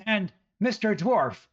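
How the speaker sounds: broom-waving woman berating the adventurers, high and shrill-voiced scolding; angry village woman. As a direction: scold them like an irate housewife with a broom. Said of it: "and she says".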